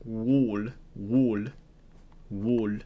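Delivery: "wool" said slowly with a rounded oo; older pronunciation. Shown as a demonstration. Player showing an old pronunciation, "wool" carefully sounded out. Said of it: "though, pronounced".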